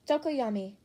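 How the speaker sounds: ordinary delivery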